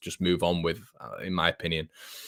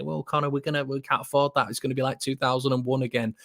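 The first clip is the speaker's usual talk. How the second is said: higher mock-whiny fan voice, pitched up; mocking doubtful fans; 7 semitones higher than usual. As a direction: parody the naysayers in a pitched-up voice. Mimicking fans who'll complain.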